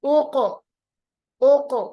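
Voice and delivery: loud ओको, the q thrown back out; qalqalah. Shown as a demonstration. Teacher throws the sound outward, bouncing ओको. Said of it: "आवाज को वापस फेंकेंगे बाहर की तरफ".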